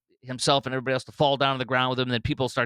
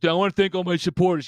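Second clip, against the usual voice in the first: mocking the politician; 5 semitones higher, 4 dB louder than usual. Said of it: "And he's like".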